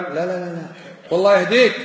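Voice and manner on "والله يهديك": a touch of exasperation, quick and firm; mock angry blessing. Father to his son when irritated, mock angry. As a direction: bless him as if losing patience. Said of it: "حتى وأنت معصب".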